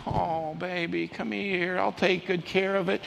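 imitating a mother's tender fussing; soothing mom. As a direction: coo it softly to a hurt child. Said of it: "Mom, on the other hand".

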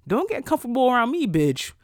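high, sassy telling-off; high sassy put-down; sassy retort. Retold or imitated. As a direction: clap back at the loyal coworker with attitude. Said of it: "I'm like".

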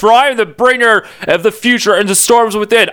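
high, grand declaration of doom; pompous mock-villain speech; proud villain boast. A narrator's reading. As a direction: boast like a self-important god. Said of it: "Apocalypse replied proudly".

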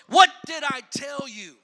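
loud, high rebuke; scolding shout. Retold or imitated. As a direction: shout it like an angry prophet. Samuel scolds Saul.

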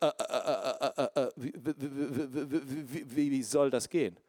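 stuttering, stuck on every wie, half laughing; imitating a stutter. Preacher imitating young Benny Hinn stuttering to God. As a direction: stammer it out like a boy who cannot get a word out. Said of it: "hat als Kind gestottert".

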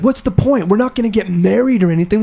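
high-pitched mock distraught pleading; hammed-up upset girlfriend; imitating bawling. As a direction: imitate a tearful rant, pitched well up. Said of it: "she's, like, crying, like, bawling".

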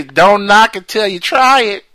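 loud, high, slow Southern drawl; mock Southern accent. Host answering with a Southern accent, loud and slow. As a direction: drawl it out like a good ol' boy. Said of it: "some would say".

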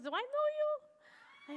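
puzzled Russian accent, lightly voiced; a hint of Vladimir's puzzled Russian accent. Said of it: "he goes".

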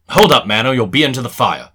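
sudden loud command; urgent warning. Acted. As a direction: call out to stop someone falling. Holmes warns fainting man.

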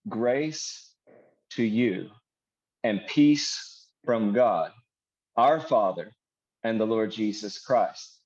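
slow, with long pauses between phrases. Shown as a demonstration. Preacher rereading verse two to let it sink in, slowly. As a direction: read it phrase by phrase, unhurried. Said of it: "I'm gonna read that again slower".

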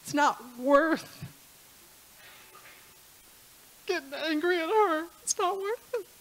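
real tears breaking through; choked up. Real, not acted. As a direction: voice rising high and tearful. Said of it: "I wasn't this emotional for a service".

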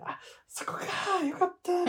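high breathy whisper of relief, drawn out; relieved whisper. Retold or imitated. Host recalling his private relief at the meeting, high whisper. Relieved inner thought, retold.

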